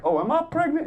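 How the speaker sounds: high, lilting mock question; mock surprised. Comic jokes to the nurse about the scan, high and mock surprised. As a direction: pop the joke up high in pitch. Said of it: "I'm like".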